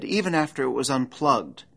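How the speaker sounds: ordinary talk, nothing marked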